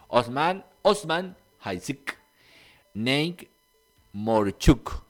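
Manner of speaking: Osman, Osman Hajduk Neik Morchuk sounded out slowly, word by word; Spanish-style pronunciation. Real, not acted. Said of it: "pronunciar como funciona en español". (Osman, Osman Hajduk Neik Morchuk, despacio, como se lee en español)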